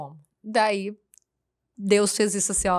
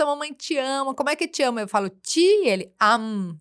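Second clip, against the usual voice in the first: usual speaking voice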